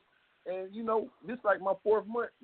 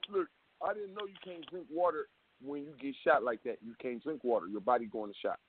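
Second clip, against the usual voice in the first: ordinary talk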